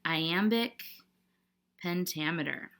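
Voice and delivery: slow, soft "iambic ... pentameter" with a long pause between the words; "iambic pentameter" spaced out quietly at writing speed; dictating while writing. Shown as a demonstration. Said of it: "that's what we're gonna write in".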